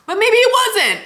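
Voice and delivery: very high, loud mock-hopeful squeal; jokey squeal. Real, not acted. Vlogger joking about whether the vlog flopped, high squealed optimism. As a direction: squeal it out, tipping into laughter.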